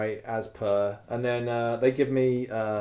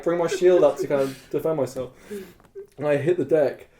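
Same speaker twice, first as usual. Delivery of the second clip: usual speaking voice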